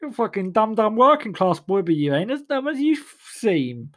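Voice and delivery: high, patronising posh voice; Oxford graduate. Retold or imitated. Oxford graduates patronising the working class boy, high posh voice. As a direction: talk down to him like a smug Oxbridge type. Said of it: "the Oxford graduates will go".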